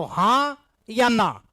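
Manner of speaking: slow, drawn-out either-or question; teacher slowly pressing for an answer; deliberate prompting. Real, not acted. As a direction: stretch each choice out with a pause. Repeats the question, demanding an answer.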